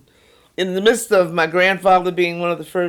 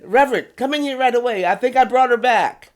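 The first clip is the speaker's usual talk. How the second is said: higher, louder, rushed summons; urgent call; 6 semitones higher, 5 dB louder, faster than usual. Retold or imitated. Doctor calling the reverend to his revived wife, urgent. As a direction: imitate an excited doctor calling out, pitched up fast.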